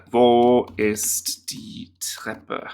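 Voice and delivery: slow whispered German. Shown as a demonstration. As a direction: whisper the question slowly. Repeating sentence from app.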